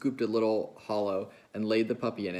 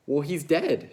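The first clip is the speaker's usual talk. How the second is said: higher, louder exclaim; cried out; 6 semitones higher, 6 dB louder, slower than usual. A narrator's reading. Curly's wife reacting to the dead puppy, crying out. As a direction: cry it out in surprise. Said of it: "she cried".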